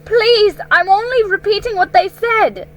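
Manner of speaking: high, loud frightened plea; a boy scared of punishment; stammering boy. Acted. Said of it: "stammered Edmund".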